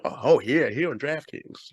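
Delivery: British speaker badly faking an American ad voice; mock American podcast plug; terrible American accent. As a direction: do a hammed-up, drawn-out American accent. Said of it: "that's my terrible American accent".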